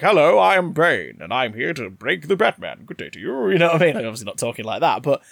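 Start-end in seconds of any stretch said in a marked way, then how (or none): 0.0-3.5 hammed-up well-spoken British gent; Bane in exaggerated RP. Shown as a demonstration. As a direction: overdo the RP British, like a lord. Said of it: "so it's an R P voice".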